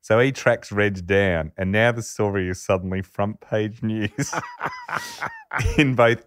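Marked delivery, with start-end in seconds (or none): 4.4-5.7 a sudden high-pitched squeal of laughter; real burst of giggling. Real, not acted. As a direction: let the laugh break up into a squeal. Laughing at the story's twist.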